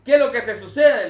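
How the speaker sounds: grita enojado, más alto y fuerte; hombre enojado. Retold or imitated. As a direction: increpa al perro con rabia fingida. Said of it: "se levanta el hombre enojado". (grito enojado del hombre al perro)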